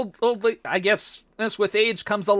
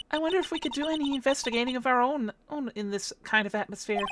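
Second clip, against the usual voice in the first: soft, light-voiced query; gentle, unsure sidekick; wondering assistant; 4 semitones higher, 7 dB quieter than usual. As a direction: wonder aloud quietly. Plays the young assistant.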